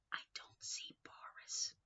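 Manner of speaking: hushed whisper. Acted. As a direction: whisper as if looking for him. Reading to a child.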